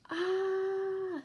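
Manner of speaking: a sudden high "Ah" of shock; startled, high-pitched "Ah"; surprised gasp. Acted. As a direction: blurt it out as the penny drops. Story character discovers his car stolen.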